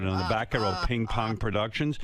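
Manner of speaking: usual speaking voice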